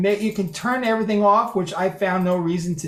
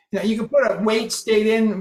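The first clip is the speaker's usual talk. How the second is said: ordinary talk, nothing marked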